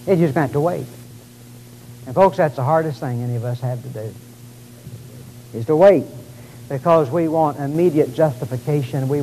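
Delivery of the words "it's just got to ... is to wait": rising preacher cadence, loud and emphatic; preaching. Acted. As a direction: preach it with full conviction. Preacher expounding scripture.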